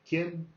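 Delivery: quién rising high at the end; rising question tune. Shown as a demonstration. Teacher showing students the question tune, rising pitch. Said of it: "The sound goes up, very high pitch at the end".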